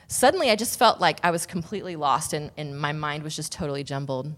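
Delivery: ordinary talk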